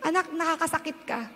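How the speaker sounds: a quiet word from the Father; gentle voice of God. Retold or imitated. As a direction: speak as God gently correcting his child. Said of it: "Ang sinabi sa akin ng Lord".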